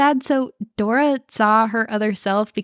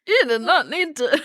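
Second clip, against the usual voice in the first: mock indignation; 5 semitones higher than usual. Mock indignant reaction to aunt's insult.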